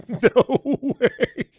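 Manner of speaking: very high words squeezed out through giggles; laughing disbelief. Real, not acted. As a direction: squeak it out mid-laugh. Cracks up in disbelief.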